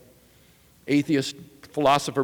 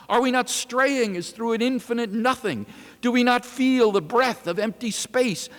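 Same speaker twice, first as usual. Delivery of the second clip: high, loud raving prophet; frantic madman; 7 semitones higher, 4 dB louder than usual. Acted. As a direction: rant at the mocking crowd. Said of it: "The madman turned in their midst".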